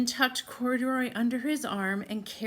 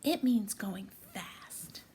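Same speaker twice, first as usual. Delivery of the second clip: soft unvoiced whisper; 4 dB quieter than usual. Real, not acted. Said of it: "answers the children's question".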